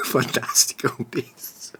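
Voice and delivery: breathy whispered repeat; whispered echo. Real, not acted. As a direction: whisper it back in disbelief. Incredulous echo of friend.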